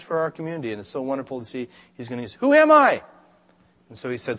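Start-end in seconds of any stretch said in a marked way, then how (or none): 2.3-3.0 high, loud, incredulous; Moses protesting. Retold or imitated. Moses protesting to God, high and loud. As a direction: cry out unworthy.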